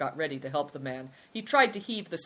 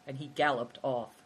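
usual speaking voice

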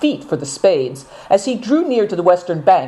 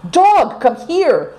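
calling out; 11 semitones higher, much slower than usual. A narrator's reading. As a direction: call the dog from afar. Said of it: "cried Seven Stars".

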